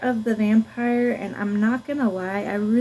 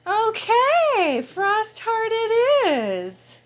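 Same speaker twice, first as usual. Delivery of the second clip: sing-song delight; 12 semitones higher, much slower than usual. Real, not acted. Dog has just picked a book.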